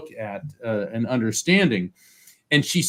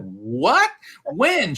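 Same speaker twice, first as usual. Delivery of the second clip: startled disbelief; 4 semitones higher than usual. Retold or imitated. Shocked at Shirley MacLaine's call.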